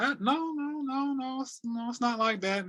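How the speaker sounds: high, soft, pleading no no no; insistent denial. Retold or imitated. Telling them how he feels, high and soft in protest. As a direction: beg them to believe it still hurts.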